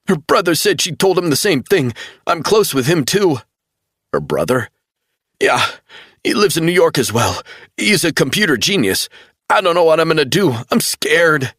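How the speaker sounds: panicked, voice tight; frightened young man. A narrator's reading. Travell frightened.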